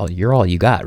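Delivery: ordinary talk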